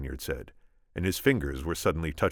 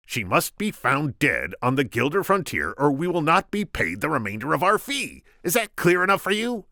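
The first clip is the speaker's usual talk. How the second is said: high, loud Vizzini voice; squeaky scheming Sicilian; 12 semitones higher, 6 dB louder than usual. Acted. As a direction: pitch him up, sharp and cocky.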